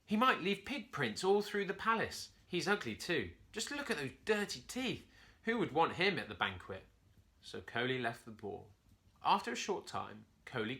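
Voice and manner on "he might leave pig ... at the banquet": disdainful monkey. A narrator's reading. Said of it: "Curly told himself".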